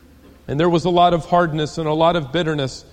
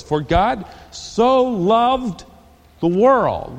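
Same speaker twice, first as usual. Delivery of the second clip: slow and higher, savouring each word; drawn-out verse; 6 semitones higher, 4 dB louder, much slower than usual. Shown as a demonstration. Preacher opening the verse, slow and lifted. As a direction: stretch out so loved. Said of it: "Let's go on in the verse".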